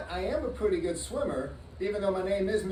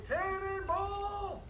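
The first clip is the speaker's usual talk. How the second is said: long high drawn-out yell; cannonball yell; 11 semitones higher, 3 dB quieter, much slower than usual. Shown as a demonstration. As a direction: stretch it out, shouted high and slow. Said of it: "you say".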